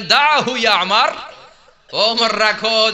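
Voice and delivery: slow, amused words with a laugh; laughing restraint. Acted. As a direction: hold him back with a chuckle. Prophet gently stops Umar. (হাসতে হাসতে ধীরে থামানো)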